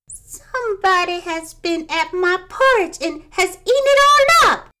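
high, loud tiny bear squeal; little wee bear voice. A narrator's reading. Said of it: "in his little small wee voice".

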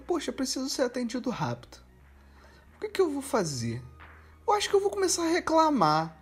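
a lightly voiced complaining client; mock inner voice. Imagining the woman's reasoning.

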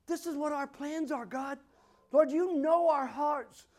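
high, fervent prayer to God; impassioned prayer. Real, not acted. Preacher praying to God on the couple's behalf, fervent. Prays aloud at high intensity.

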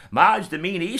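sing-song scolding in a fifties voice; old-fashioned fifties voice. Retold or imitated. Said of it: "really old-fashioned, like, nineteen fifties voice".

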